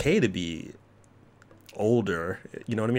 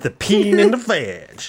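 louder, slow, hammed-up; mock title; 9 semitones higher, 7 dB louder, slower than usual. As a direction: draw it out grandly. Repeated joke title.

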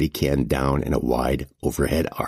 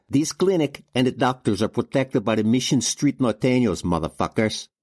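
put-on defiant young banger; 8 semitones higher than usual. A narrator's reading. Banger warns the thugs off the clinic, defiant. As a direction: lay down the gang's claim, pitched up.

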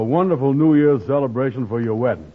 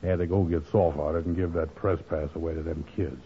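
glum and alone; 5 semitones lower, 6 dB quieter than usual. Acted. Andy sulking alone at home on New Year's Eve, softly glum. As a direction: sigh it out like a man who gave his night away.

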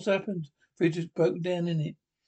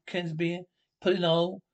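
usual speaking voice

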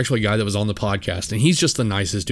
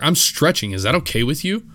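a sharp sarcastic comeback, pitched up; aggressive reply; 6 semitones higher than usual. Retold or imitated. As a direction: snap it back quick and higher, with attitude. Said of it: "quick, aggressive reply".

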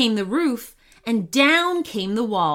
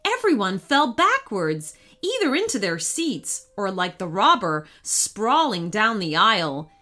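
usual speaking voice

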